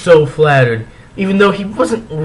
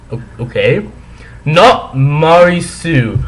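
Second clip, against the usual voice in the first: usual speaking voice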